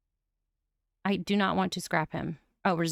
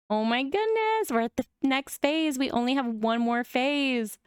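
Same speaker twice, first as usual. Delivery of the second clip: high excited squeal; 5 semitones higher than usual. Real, not acted. Reaching next phase, thrilled.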